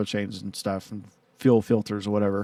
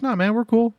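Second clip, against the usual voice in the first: much higher, breezy mock buddy voice; imitating buddy; 11 semitones higher than usual. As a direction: toss it off high like the laid-back friend. Friend's easygoing reply retold.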